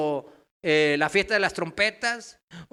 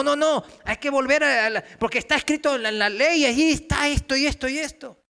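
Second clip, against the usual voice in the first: loud, high-pitched insistence; imitated legalistic teachers; 7 semitones higher, 5 dB louder than usual. False teachers insist on returning to the law, loud imitation. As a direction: argue loudly, piling up the proof. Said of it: "Le estaban diciendo".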